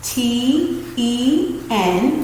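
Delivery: very slow letters T E N; teacher spelling tennis; spelling out slowly. Shown as a demonstration. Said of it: "I'll show you what I mean".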